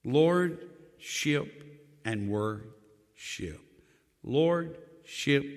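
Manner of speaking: slow, deliberate contrast of two words; slow emphasis. Acted. Pastor spelling out the difference slowly. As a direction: lean on each word slowly.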